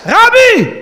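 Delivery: high, loud urgent call; shouted alarm to the rabbi; urgent cry. Retold or imitated. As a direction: burst in calling out to the rabbi. Messengers bursting in on rabbi.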